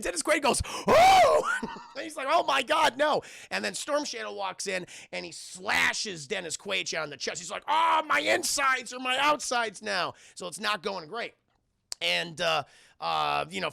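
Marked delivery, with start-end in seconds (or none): 0.9-1.5 loud, very high-pitched howl; hammed-up horrified wail; mock scream. Imitates the actor's scream. 2.3-3.2 high, loud hammed-up dismay; comic panicked victim; mock horror. 7.7-10.1 high, slow hammed-up wail; exaggerated dying complaint; mock agony. Acted cry of slashed man.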